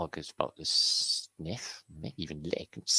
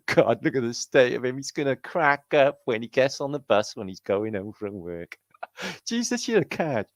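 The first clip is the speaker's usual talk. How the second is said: laughing; 7 semitones higher, 6 dB louder than usual. Acted. Laughing camp voice.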